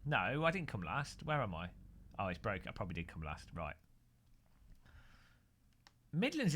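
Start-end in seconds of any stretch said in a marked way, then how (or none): 0.1-3.8 much softer, lower self-talk; quietly muttering at the screen; muttered aside. Real, not acted. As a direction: mumble to yourself, deflated. Muttering to himself about race result.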